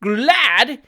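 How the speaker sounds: a lifted cry of surprise. Acted. Job Trotter protests his gladness, exclaimed. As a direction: throw the word up in protest. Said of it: "exclaimed Job Trotter".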